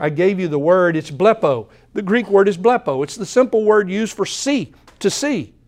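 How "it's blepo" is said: ordinary talk, nothing marked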